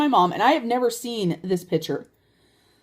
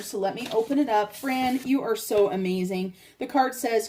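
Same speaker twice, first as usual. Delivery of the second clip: ordinary talk, nothing marked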